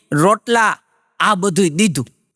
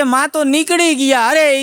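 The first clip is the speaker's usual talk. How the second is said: alarmed exclamation; 6 semitones higher than usual. Retold or imitated. Wife tells husband the mother-dog has left, alarmed. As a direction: blurt it out in shock.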